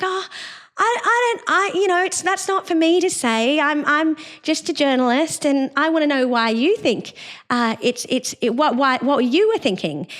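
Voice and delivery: high, lilting, mock-sweet voice; sing-song voice. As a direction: imitate her sugary high sing-song. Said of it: "this really annoying sing-song voice".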